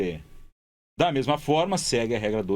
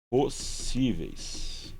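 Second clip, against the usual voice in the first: Possíveis split slowly into its syllables; slow syllable-by-syllable reading; 3 dB quieter, much slower than usual. Shown as a demonstration. Teacher separating syllables for the class, very slow. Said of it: "vamos fazer a separação silábica de possíveis".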